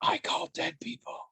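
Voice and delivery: hint of a spooky child whisper; lightly voiced creepy child; Sixth Sense kid. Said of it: "kid from The Sixth Sense".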